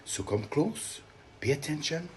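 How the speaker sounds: ordinary delivery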